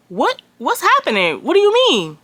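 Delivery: high, rising bewildered questions; shocked disbelief. Retold or imitated. On the phone with the front desk, high shocked disbelief. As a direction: fire off confused questions, pitch climbing. Told to leave again.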